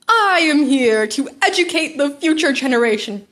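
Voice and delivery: loud, proud hero announcement; booming hero voice. A narrator's reading. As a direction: boom it out like a superhero. All Might's booming entrance.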